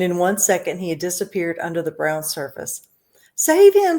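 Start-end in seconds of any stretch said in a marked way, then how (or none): 3.3-4.0 high, loud terrified scream; panicked scream. A narrator's reading. Mother screaming as her son falls in the river. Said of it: "screamed Missus Gloop".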